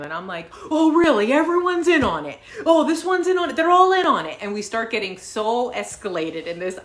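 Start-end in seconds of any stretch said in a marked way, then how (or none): 0.7-4.4 loud, high mocking retort; reenacted argument, pitched way up and loud; sarcastic yelling. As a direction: yell back in scornful disbelief. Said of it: "I start yelling at him".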